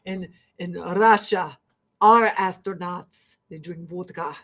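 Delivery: Russian hosts bragging about space vodka, in a mock Russian accent. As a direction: drag out the words like a proud Russian host. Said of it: "they're like".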